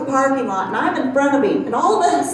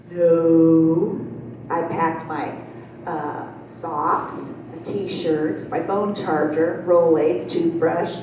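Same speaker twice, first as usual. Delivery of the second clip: lower, softer, a hint of a sheepish husband; husband answering his wife, lower and softer; lightly voiced sheepish husband; 8 semitones lower, 4 dB quieter, slower than usual. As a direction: mumble the list like a guilty husband. Husband voiced listing packed items.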